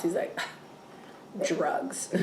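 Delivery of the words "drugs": scoffing. Retold or imitated. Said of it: "Drew, like, scoffs".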